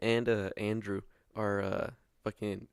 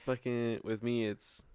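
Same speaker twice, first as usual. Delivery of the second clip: ordinary delivery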